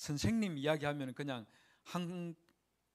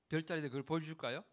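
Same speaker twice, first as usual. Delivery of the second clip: ordinary talk, nothing marked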